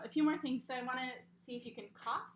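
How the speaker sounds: normal talking voice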